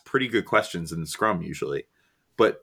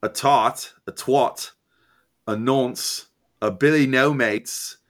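higher put-on British accent; 4 semitones higher, much slower than usual. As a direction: reel off insults in mock British. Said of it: "should I try to do it in a British accent?".

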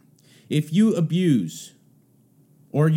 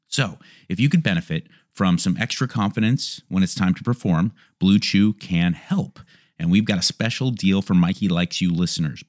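ad read; 4 semitones lower, 3 dB louder than usual. Acted. Host reads sponsor ads.

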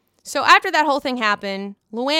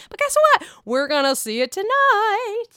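much higher and louder hype; excited tease; 10 semitones higher, 4 dB louder than usual. Real, not acted. Vlogger hyping tonight's episode to viewers, excited. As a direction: sell the cliffhanger like a trailer.

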